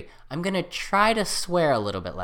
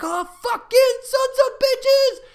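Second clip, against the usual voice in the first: much higher, slow strangled swearing; furious cursing; 6 dB louder, slower than usual. Retold or imitated. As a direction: explode in a shrieking curse. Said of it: "I'm just like".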